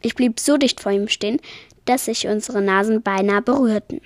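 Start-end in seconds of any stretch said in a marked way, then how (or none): none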